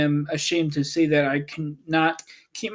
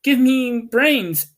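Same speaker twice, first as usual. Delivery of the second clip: higher, slower pleading voice; Scarecrow pleading; 8 semitones higher, 4 dB louder than usual. A narrator's reading. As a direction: beg slowly for brains. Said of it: "asked the Scarecrow".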